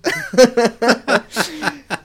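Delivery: big burst of laughter, high; loud laugh. Real, not acted. Loud laugh after quote.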